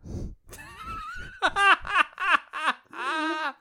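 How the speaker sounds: long, high-pitched laughing fit; helpless laughter. Real, not acted. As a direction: crack up completely. Cracks up at the RoboCop joke.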